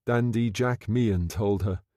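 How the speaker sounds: ordinary delivery, nothing marked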